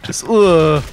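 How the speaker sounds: lazy grunt. Retold or imitated. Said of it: "That overhead swing".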